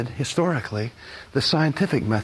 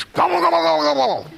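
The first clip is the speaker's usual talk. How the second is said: put-on much higher, squeaky gobbling for a child; turkey gobble; 14 semitones higher, 4 dB louder, faster than usual. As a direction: play the turkey in a picture book. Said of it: "The turkey says".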